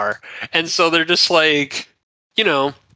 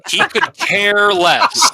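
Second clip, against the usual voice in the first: high laugh breaking through; real laugh on the words; 7 semitones higher, 3 dB louder, slower than usual. Real, not acted. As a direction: laugh it out, slow.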